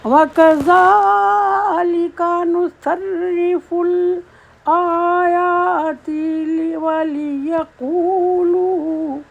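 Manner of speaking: slow, high, drawn-out chant; Quranic recitation. Acted. Teacher reciting the verse for her students, slow chant. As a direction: stretch each word in tajweed style.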